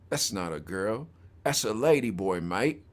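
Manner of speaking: tossing it off like an Aussie commenter; a light Australian accent, dry and low. Retold or imitated. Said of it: "I always say this one in an Australian accent".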